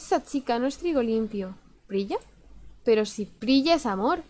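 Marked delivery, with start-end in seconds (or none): none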